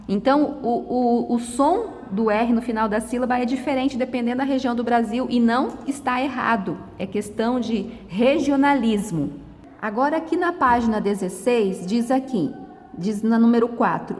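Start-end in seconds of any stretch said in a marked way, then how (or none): none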